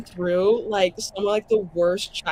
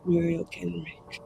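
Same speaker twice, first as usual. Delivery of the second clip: resounding warmth; 2 semitones lower, 10 dB quieter than usual. Acted. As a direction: intone her name like a god speaking from the light. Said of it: "resounding warmth in the voice of the Maker".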